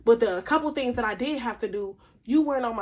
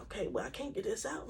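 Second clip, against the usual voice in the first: much softer, half under the breath; quiet frustrated aside; frustrated mutter; 13 dB quieter than usual. Pretend. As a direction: grumble to yourself, stuck and annoyed. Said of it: "You're like".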